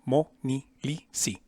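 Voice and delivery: ordinary talk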